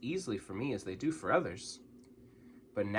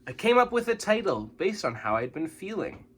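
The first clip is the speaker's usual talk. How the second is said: usual speaking voice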